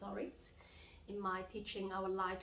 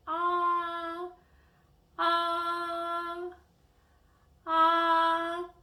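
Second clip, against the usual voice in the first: high, level, long-held "Ah", loud; doctor's flat "ah"; 10 semitones higher, 12 dB louder, much slower than usual. Shown as a demonstration. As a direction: open up and hold "Ah" high for the doctor. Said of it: "open your mouth and say".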